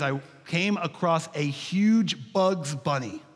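ordinary talk

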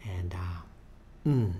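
ordinary talk

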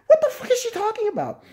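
high, loud disbelieving shriek; high shocked screech; incredulous shriek. Real, not acted.

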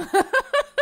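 loud, high laugh; sudden high-pitched laughing fit; burst of laughter. Real, not acted. Breaks up after dark reveal.

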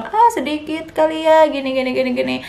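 mock the people who doubt her milk; doubting relatives. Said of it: "banyak yang bilang".

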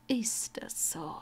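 soft, slow whispered question; quiet menace in a whisper; cool whisper. Acted. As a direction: breathe it out, unimpressed. Snape coolly questions dying Malfoy.

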